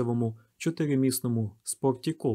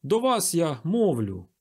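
higher, slow, emphatic address; slow raised-pitch oration; public appeal; 5 semitones higher, 4 dB louder, slower than usual. A narrator's reading. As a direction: call for the crowd's attention. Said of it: "Він ще ніколи не говорив ось так публічно".

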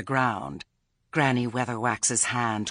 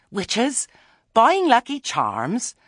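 high, loud incredulous questions; scandalised disbelief; 12 dB louder, slower than usual. A narrator's reading. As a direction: exclaim in scandal, voice shooting up. Scandalised reply to Nanny's accusation.

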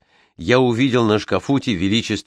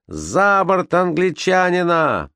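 slow, high, drawn-out crowd shout; 8 semitones higher, slower than usual. Acted. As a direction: call for him to go overboard, high and slow. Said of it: "крикнул кто-то из матросов".

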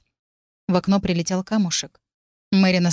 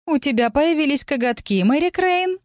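higher, mocking taunt; 8 semitones higher than usual. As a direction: needle her playfully. Amelia taunting Mary.

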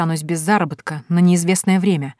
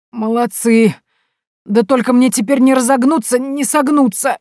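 put-on high, loud old man's wail; lamenting; 7 semitones higher, 5 dB louder than usual. A narrator's reading. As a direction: complain about the aching back. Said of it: "запричитал дядюшка". (причитание старика, громко и высоко)

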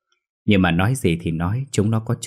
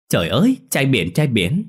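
gushing; 6 semitones higher, 4 dB louder than usual. Acted. Friend gushes hugging Quang.

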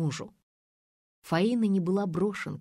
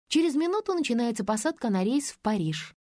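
rattling off; 6 semitones higher than usual. A narrator's reading. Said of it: "затараторила кассирша".

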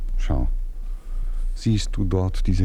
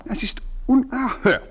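indignant outburst; 13 semitones higher than usual. Acted. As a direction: pitch jumps far higher on unerhört. (empörter Ausruf, viel höher)

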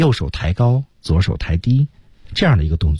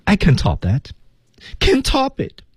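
higher acted cry, leaping up on the repeat; theatrical despair; 12 semitones higher than usual. Acting out the scene with gestures, theatrical despair. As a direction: perform it big, pitch climbing sharply. Performs the whole routine with gestures.

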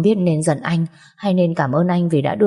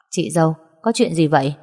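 plain reading voice

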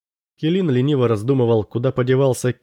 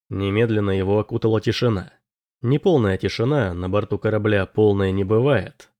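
usual speaking voice

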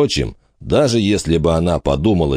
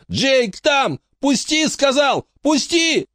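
put-on very high, desperate child's wail; boy fights to reach his trapped friend, in tears; tearful cry; slower than usual. A narrator's reading. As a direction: struggle free while sobbing. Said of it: "захлёбываясь слезами, прокричал Жуки".

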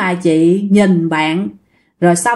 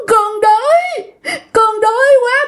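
put-on much higher, slow sobbing whine like a spoiled child; whiny childlike crying; 13 semitones higher, slower than usual. Possessed woman whining to the security guard, crying like a child. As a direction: whimper it like a pouting child in tears. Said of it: "vừa khóc vừa nói ... con nít mà nó nhõng nhẽo".

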